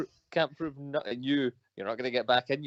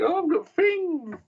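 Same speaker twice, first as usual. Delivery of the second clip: high, slow delighted squeal of joy; excited squeal; 5 dB louder than usual. Retold or imitated. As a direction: squeal it like a thrilled kid. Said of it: "And I was like".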